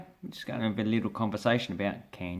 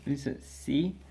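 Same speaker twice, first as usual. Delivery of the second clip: ordinary talk, nothing marked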